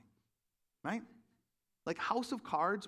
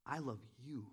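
tender divine words; 3 semitones lower, 9 dB quieter than usual. Retold or imitated. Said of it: "I felt like God said".

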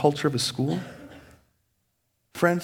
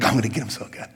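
sly scheming; 5 semitones lower, faster than usual. Retold or imitated. Poster plotting a comeback, low and sly. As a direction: plot it under your breath.

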